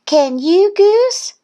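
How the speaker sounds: high, loud taunting question; challenging. A narrator's reading. Thistle challenging Goose, high taunt. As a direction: dare in a high little-duck voice.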